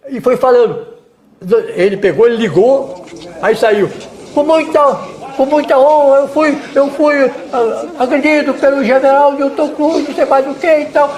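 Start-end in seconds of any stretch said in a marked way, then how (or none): 4.3-11.1 high-pitched imitation of an agitated reporter; agitated journalist. Journalist telling viewers the general attacked him, mock agitated. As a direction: mimic his complaint in a raised, insistent voice. Retelling the journalist's on-air complaint.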